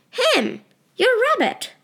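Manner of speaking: high, sharp disbelief; mother can't believe her ears; incredulous mother. Acted. Mother incredulous about the rabbit.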